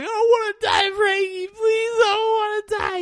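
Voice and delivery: high-pitched, loud, tearful begging; terrified pleading. Retold or imitated. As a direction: wail for mercy in a squealing mock cry. Retells victim begging for life.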